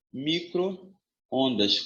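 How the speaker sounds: micro... ondas, pulled apart slowly; very drawn-out, measured delivery; slow dictation. Shown as a demonstration. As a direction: dictate the two halves with a gap. Shows the two joined parts.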